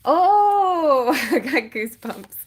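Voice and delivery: gasp, then a loud high "oh"; loud, drawn-out awestruck cry; real amazement. Real, not acted. Reader seeing the next card turn up, amazed.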